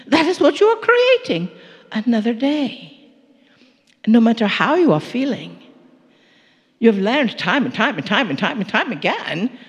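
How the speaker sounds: ordinary talk, nothing marked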